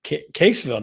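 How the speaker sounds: ordinary talk, nothing marked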